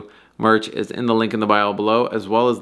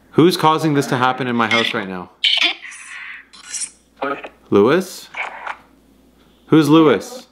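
raised voice asking the spirit; calling out; 5 semitones higher than usual. Real, not acted. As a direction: call out to an unseen presence.

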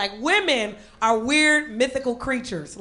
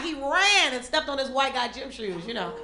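ordinary talk

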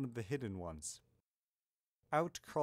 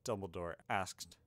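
plain reading voice

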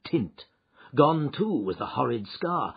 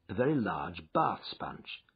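usual speaking voice

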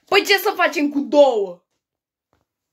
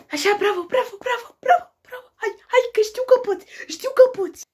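hammed-up cheering; 10 semitones higher than usual. Cheering on English learner.

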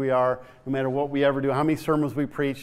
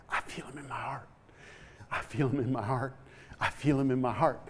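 much softer, hushed repeat; 8 dB quieter than usual. Retold or imitated. Old man quietly telling the preacher, hushed. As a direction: say it hushed and moved.

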